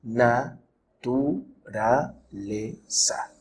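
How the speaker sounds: very slow, quiet, split into na-tu-ra-le-za; syllable by syllable. Shown as a demonstration. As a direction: sound out naturaleza with a pause after each syllable. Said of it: "cómo se dividen las palabras en sílabas".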